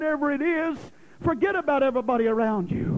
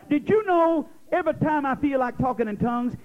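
ordinary talk

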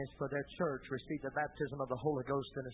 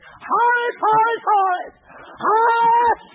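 frantic scream, far higher and louder; screaming a name; 17 dB louder, much slower than usual. Retold or imitated. Vicar's wife bursting into the study, screaming her husband's name. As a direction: shriek his name as you burst in. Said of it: "come running through the house into the study screaming".

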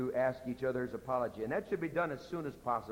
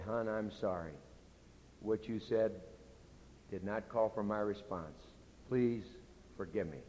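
low, soft, gentle plea; contrite apology; 4 semitones lower, 5 dB quieter than usual. Retold or imitated. Husband retelling his apology to his wife, contrite. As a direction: ask forgiveness quietly and humbly. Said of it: "and said".

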